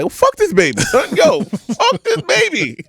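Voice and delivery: cracking up as he fakes his outrage at the baby; mock exasperated. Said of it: "I'm looking at this baby like".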